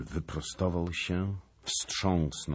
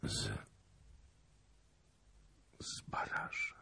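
weak, halting whisper; 11 dB quieter than usual. A narrator's reading. Exhausted stranger answering the king who he is, whispered. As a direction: barely get the words out in a whisper. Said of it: "zdołał wyszeptać tylko".

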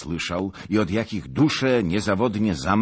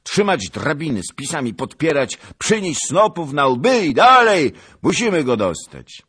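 loud, higher orders; commanding shout; 9 semitones higher, 10 dB louder than usual. Acted. Cossack shouting commands to climb, loud. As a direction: drive the men up the ladders.

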